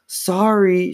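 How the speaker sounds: high, restrained mock scream; strained high cry, not full volume. A narrator's reading. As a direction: force the word out high-pitched. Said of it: "she finally managed to scream".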